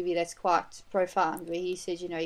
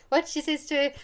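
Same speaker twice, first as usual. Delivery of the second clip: ordinary talk, nothing marked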